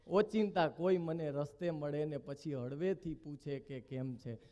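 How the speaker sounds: closing the poem, softly and low; soft poem recital. Shown as a demonstration. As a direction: let the "ઓચિંતા" line fade away to a hush. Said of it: "ધ્રુવ ભટ્ટના શબ્દોથી કરું".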